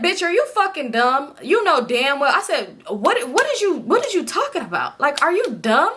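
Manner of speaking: high-pitched mock outrage at a friend; exasperated disbelief. Storyteller replaying her telling-off of a friend, exasperated and high. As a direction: act out scolding someone in utter disbelief.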